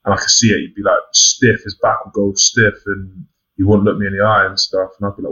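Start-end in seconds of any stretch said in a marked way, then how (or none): none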